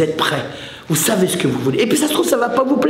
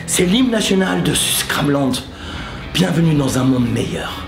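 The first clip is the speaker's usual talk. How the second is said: mock solemnity; 2 semitones lower than usual. Mock solemn anthem presentation.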